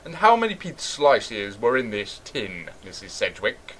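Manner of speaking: low, soft, measured questioning; solemn judge. Pretend. Judge questioning accused shoplifter, low solemn voice. Plays judge in courtroom skit.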